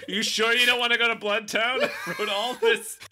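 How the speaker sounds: high-pitched, exasperated plea from a game master; frustrated dungeon master. Acted. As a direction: plead like a dungeon master losing the players. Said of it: "dungeon master who's getting really frustrated".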